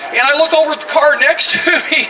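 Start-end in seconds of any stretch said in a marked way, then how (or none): none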